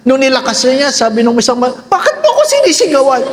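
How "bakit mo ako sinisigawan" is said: put-on high, fast indignant old-person voice; hammed-up offended granny; offended old parent. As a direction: fire it back like you're being yelled at. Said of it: "nilakasan ng anak ang boses".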